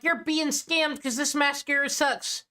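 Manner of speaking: high-pitched mock complaint; mock complaining customers. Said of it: "and they're like".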